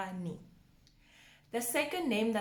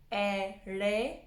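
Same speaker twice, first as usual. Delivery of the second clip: "Ererwani" syllable by syllable with long pauses; broken down slowly. Shown as a demonstration. Teacher breaking down a tongue twister, slow separated syllables. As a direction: spell out the sounds of "Ererwani" very slowly. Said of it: "break it down and say it slowly".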